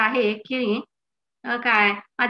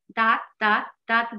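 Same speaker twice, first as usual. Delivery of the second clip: ordinary talk, nothing marked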